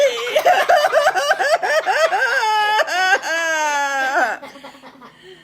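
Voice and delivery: long, high, loud wail; hammed-up drawn-out crying; comic bawling. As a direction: bawl it out in mock despair. Pig bawls over curtsy.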